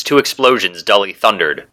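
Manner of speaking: usual speaking voice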